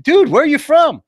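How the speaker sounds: loud, high incredulous demand; exasperated. Acted. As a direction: challenge the agent, fed up. Irritated customer confronts agent.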